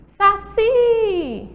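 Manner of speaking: "Saci" with CI held long and high; exaggerated long CI in "Saci"; final syllable stressed. Shown as a demonstration. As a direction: call out "Saci", stretching the last syllable. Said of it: "termina com a letrinha I".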